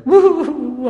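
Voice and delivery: sudden high, loud whoop; excited whoop. Retold or imitated. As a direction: whoop it out like an eager caller. Excited caller on phone.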